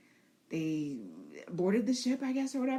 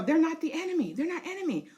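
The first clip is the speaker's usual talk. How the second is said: high, urgent pleading with the dragon; high frantic rider pleading; 6 semitones higher than usual. Pretend. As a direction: stop the dragon from attacking friends. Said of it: "she's trying to tell him".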